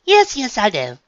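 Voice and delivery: high, loud brush-off; dismissive. A narrator's reading. Unicorn brushing off her friend's protest, dismissive. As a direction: wave it away airily. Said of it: "a hoof waved dismissively".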